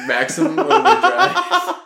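high, laughing hype of the title; excited squeal. Real, not acted. Hyping the film title.

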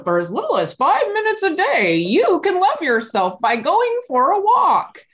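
high, bright TV sales pitch; infomercial voice. Shown as a demonstration. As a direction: sell it like a late-night infomercial announcer. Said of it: "it sounds like an infomercial".

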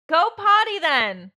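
loud call through the house; slow, loud parental call; real shout. Real, not acted. Calling to child in another room.

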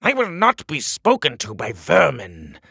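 loud, high contemptuous king; pompous shrill tyrant. Acted. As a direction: spit out the insult. King voice held.

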